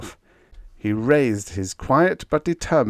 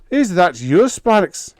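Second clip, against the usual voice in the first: loud, higher angry shout; angry bellow; 10 semitones higher, 8 dB louder than usual. A narrator's reading. Engineer bellowing at Sparks over the line, angry. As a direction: bellow it at him angrily. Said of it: "he bellowed angrily".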